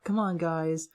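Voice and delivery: imitated kid's plea, pitched very high; shouting kid. Kid calling teammates in game voice chat, mock shouting. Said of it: "one kid that's, like, shouting".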